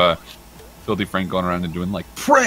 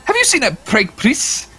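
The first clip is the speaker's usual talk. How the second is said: [name] imitation; 6 dB louder than usual. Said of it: "He just goes".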